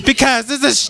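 high, heated comeback; yelling. Real, not acted. Answering why there is yelling, still yelling. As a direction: fire it back at a high-pitched yell. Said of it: "Why are you yelling at me?".

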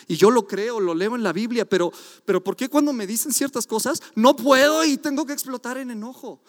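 normal talking voice

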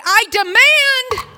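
loud, slow mock demand. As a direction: drag out a pushy demand at the teller. Demanding money at bank.

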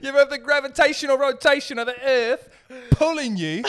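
high, loud British philosopher-lad; mock British accent. Co-host asks about Earth's pull, mock British accent. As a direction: push it out in a hammed-up British accent. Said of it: "it's an easy impression".

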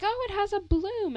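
high gushing mom imitation. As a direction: mimic a mom thrilled over a flower. Mom voiced excitedly.

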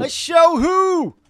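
loud, slow mock shout; slow drawn-out shout. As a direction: stretch it into a big yell. Shouted reply to knock-knock.

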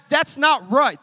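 not hit hard; stress on not. Acted. Preacher pressing "not" for the congregation. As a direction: lean on "not" in "that's not right". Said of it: "stress on not".

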